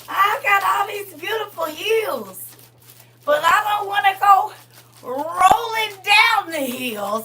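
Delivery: loud, high comic wailing plea; mock wail. Joking host wails to God about her knees. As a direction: ham up the despair.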